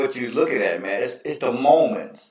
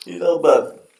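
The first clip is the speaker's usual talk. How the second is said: usual speaking voice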